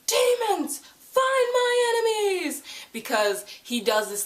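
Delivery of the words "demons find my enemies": high, hammed-up villain order; commanding shout. Valentine summoning demons against his enemies, commanding shout.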